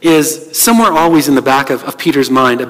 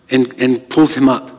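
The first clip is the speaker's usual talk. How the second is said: ordinary talk, nothing marked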